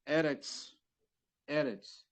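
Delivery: Eretz said twice, second time unvoiced; Hebrew word modeled. Shown as a demonstration. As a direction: model Eretz, then repeat it in a whisper. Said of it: "Mesma coisa aqui".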